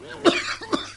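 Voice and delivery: strangled cough as the tie tightens; choking cough. Acted. Doctor choked by his secretary's grip, coughing.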